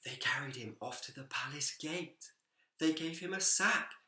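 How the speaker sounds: ordinary delivery, nothing marked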